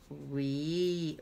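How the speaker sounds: ordinary talk, nothing marked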